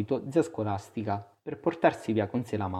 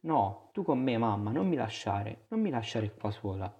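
ordinary delivery

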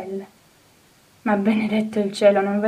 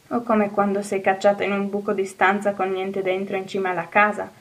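usual speaking voice

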